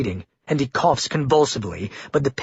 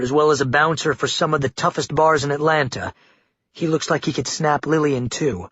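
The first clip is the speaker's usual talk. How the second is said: plain reading voice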